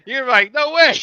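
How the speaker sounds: high, loud laughing no way; excited, laughing disbelief. Real, not acted. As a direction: squeal it through laughter. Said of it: "laughing, rising to high exclamation".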